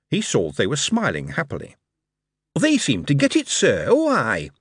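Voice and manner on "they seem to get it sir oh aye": lightly voiced keen workman; Wally, keen young engineer. A narrator's reading. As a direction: report to the boss with a lift in pitch.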